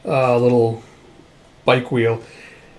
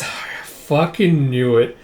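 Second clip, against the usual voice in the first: ordinary delivery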